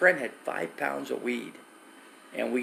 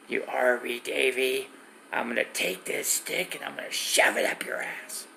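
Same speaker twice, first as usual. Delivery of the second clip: masked prankster threat, voice half gone to a rasping whisper; Caesar. Retold or imitated. Caesar in his mask video threatening Davey, mock menace. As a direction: imitate a menacing masked man. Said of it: "the video he did of me with the mask".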